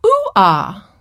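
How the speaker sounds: "Ooh-ah" for doggy, wrong vowels and no consonants; toddler's incorrect vowels. Retold or imitated. Toddler pointing at a dog, "Ooh-ah" with wrong vowels. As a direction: say "Ooh-ah", two bare vowels, falling away. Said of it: "the child points to the animal and says".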